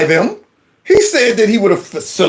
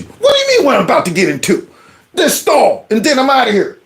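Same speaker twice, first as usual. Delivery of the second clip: higher, quick indignant retort; acted indignation; 7 semitones higher, faster than usual. Man answering a nosy stranger at the stall, mock indignant. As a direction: act put out by a nosy question.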